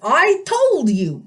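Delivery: put-on high, slow indignant old satyr; protesting satyr. A narrator's reading. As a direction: insist like a cranky old goat. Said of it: "the satyr protested".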